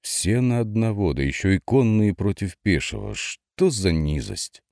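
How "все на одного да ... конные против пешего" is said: ordinary delivery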